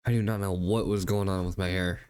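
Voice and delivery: congested, stuffy voice; talking through a stuffy nose; blocked nose. Real, not acted. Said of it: "I'm sorry if you guys can hear that in my voice".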